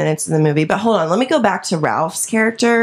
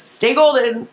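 quoted movie line; 6 semitones higher, slower than usual. Retold or imitated. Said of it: "she says".

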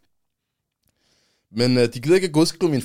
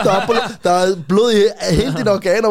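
ordinary delivery

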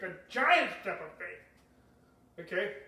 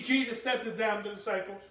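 usual speaking voice

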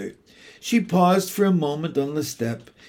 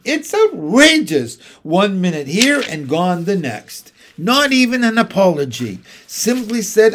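much higher, louder outraged mother; indignant rant; 5 semitones higher, 7 dB louder than usual. Acted. Mrs Banks ranting to the children, outraged and loud. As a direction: huff and puff with outrage.

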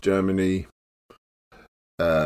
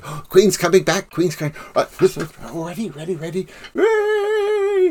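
high, eager ready-ready building to a whoop; excited countdown; 8 semitones higher than usual. Retold or imitated. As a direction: wind the kids up, then release. Clown getting kids ready.

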